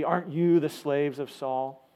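ordinary talk

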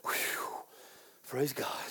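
relieved sigh. Retold or imitated. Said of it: "others of you said".

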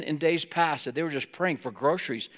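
ordinary talk, nothing marked

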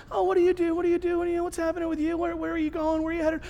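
chill small talk. Acted. Passengers chatting over drinks on the cruise, chill. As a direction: make idle cruise chatter, easygoing. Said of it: "Everybody's just chill".

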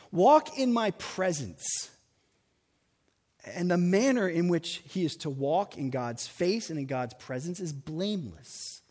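ordinary talk

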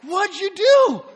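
slow, high, loud accusing demand; wife confronting husband over hurt son; alarmed wife. Retold or imitated. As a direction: drag it out like a shocked mom. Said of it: "my wife's like".

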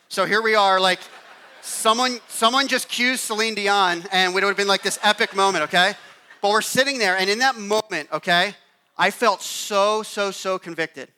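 emotional build. Real, not acted. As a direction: swell like a movie moment. Emotional climax, mock epic moment.

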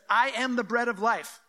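emphatic, every word landed. Acted. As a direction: declare it with force. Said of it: "he just gets more emphatic".

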